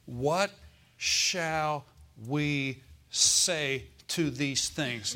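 very slow, each word stretched; drawn-out scripture reading. Acted. Preacher to congregation, reading Paul's question slowly. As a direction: let the question hang in the air.

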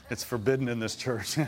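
said through laughter; preacher laughing at his own joke. Real, not acted. Joking aside to congregation.

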